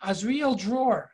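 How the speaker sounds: normal talking voice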